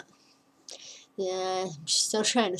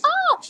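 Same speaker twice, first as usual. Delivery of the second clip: a high, loud shriek of delight; excited squeal; 7 dB louder than usual. Real, not acted. Reading his text, a high delighted squeal.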